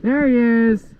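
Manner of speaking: sudden high call; excited shout. Real, not acted. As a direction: shout it out in alarm. Spotting enemy in firefight.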